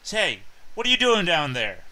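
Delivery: loud, high shout; voice calling from behind. Acted. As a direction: call out sharply to someone at a distance. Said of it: "another voice from behind you say".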